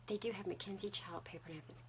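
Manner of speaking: hushed; whisper. Real, not acted. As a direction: let it slip out under the breath.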